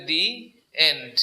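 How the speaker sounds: "the end" as DEE, pause, end; thee before vowel. Shown as a demonstration. Teacher confirming the rule, "the end" as dee end. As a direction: separate the words, "the end" with a long ee. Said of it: "এখন উচ্চারণ হবে".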